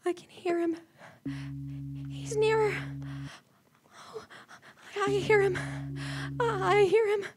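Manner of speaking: soft, fearful murmur; woman hearing the killer near; terrified hush. Acted. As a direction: barely breathe the words.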